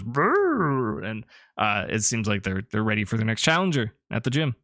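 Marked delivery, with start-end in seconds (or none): 0.0-1.1 rumbling creature growl; Pokemon growl. Acted. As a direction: growl like a big fighter. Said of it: "Hariyama goes".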